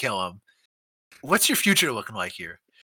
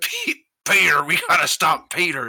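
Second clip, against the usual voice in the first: hammed-up heroic urgency, a little louder; mock action hero; 4 dB louder than usual. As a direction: parody a dead-serious movie hero. Mocking the movie's hero lines.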